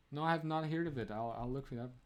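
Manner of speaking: ordinary talk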